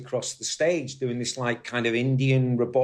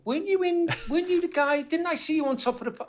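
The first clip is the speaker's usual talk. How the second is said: much higher, imitating a starstruck customer; mock shop customer; faster than usual. Shop customer recognizing the ex-guitarist, mock high voice. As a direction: pipe it up high like a fan half-recognizing someone. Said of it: "People used to come in and go".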